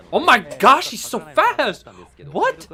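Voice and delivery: high loud gasping surprise; shocked outburst. Real, not acted. Streamer startled by a fast chaser, shocked outburst. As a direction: blurt it out in disbelief.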